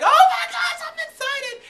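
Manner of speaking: high, loud squeal of delight; squealing excitement. Retold or imitated. Fan squealing as [name] comes onstage, high and loud. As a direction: shriek it like a thrilled fan seeing her idol.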